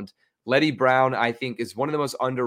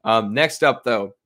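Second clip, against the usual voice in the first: ordinary talk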